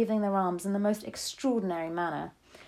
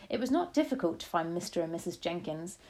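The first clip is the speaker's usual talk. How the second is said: ordinary delivery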